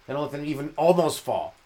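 ordinary talk, nothing marked

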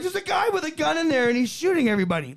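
high, hurried panicked bystander; acted panic, rushing at the end; mock panic. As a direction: raise the alarm in a high voice. Panicked crowd voiced in story.

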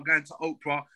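ordinary talk

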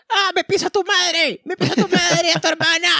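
very high, slow squeaky raging voice; mock gamer rage. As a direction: screech it like a kid raging at a game. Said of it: "cuando el maje le estaba diciendo".

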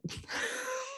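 short high giggle burst; high squeaky laugh. Real, not acted. Laughs at own story.

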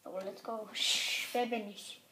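usual speaking voice

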